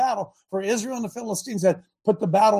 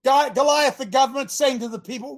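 ordinary talk

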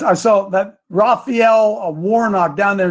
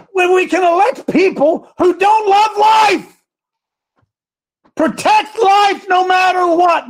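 much higher and louder, slowed near the end; fired-up shout; 11 semitones higher, 3 dB louder than usual. Real, not acted. Host rallying listeners to protect life, shouting. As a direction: preach it at the top of your voice. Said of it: "Bo, I'm hot today".

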